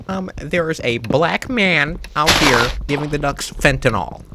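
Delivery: high, slow, mock-alarmed lady voice; imitating complaining lady. Lady calling the police on a man, mock-alarmed. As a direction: report it slowly in a put-on high voice. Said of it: "she called the police and she was like".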